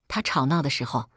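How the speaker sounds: ordinary delivery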